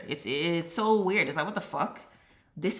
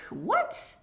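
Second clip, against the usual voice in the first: incredulous what; 14 semitones higher, 5 dB quieter than usual. Real, not acted. Incredulous reaction.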